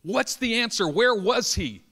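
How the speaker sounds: acted-out forceful questioning; insistent demand. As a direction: press hard for a reply. Preacher presses man for answer.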